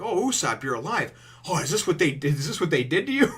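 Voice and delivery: high, loud acted astonishment; excited exclaiming. Luffy finding Usopp alive and busted up, excited exclaiming. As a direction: imitate an excited friend's amazed reaction.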